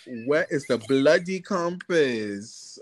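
slow, drawn-out mock-British; horrible British accent. Co-host joining the crumpets joke, slow fake British accent. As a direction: stretch it out in a bad British accent. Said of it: "Our British accents are horrible".

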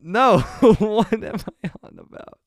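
loud, high, cracking up; laughing in exasperation. Real, not acted. Host realising they got the dates wrong, laughing exasperation. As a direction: burst into laughter through the words.

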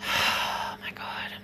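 slow breathy whispered "Oh my God"; whispered sigh. Real, not acted. Podcaster sighing at the cookie smell, whispered. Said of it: "the whole house smells like cookie".

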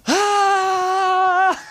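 high ecstatic cry of joy; a young man shouts for joy behind the preacher, high ecstatic cry; joyful shout. Retold or imitated. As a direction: let out a sudden high yell of joy.

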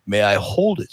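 low, mumbling Stallone impression. Stallone asks to hold it.

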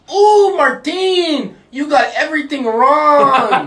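high, slow, sing-song teacher; mocking teacher voice. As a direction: drawl it like a smug teacher. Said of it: "She said".

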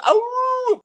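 high, loud wolf howl; imitated wolf howl. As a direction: howl up high like the wolves in the woods. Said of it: "o uivo dos lobos".

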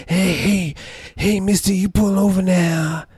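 put-on high-pitched rural Oregon accent, "you pull over now" slow; mock a creepy rural Oregon checkpoint guy. Mocking how rural Oregon checkpoint guys talk, high rural Oregon accent. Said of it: "the rural Oregon accent".

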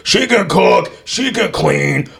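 slow, straining theatrical oratory; overblown outrage. Acted. Courtroom baffled by a woman killer, hammed-up outrage. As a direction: build with mock drama to a long drawn-out final word. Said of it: "Don't hurt yourself".

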